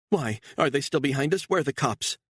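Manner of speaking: high, alarmed cry; panicked woman. A narrator's reading. Woman seeing the pursuers in the mirror, high and panicked. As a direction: spot them and panic. Beatrice voiced by narrator.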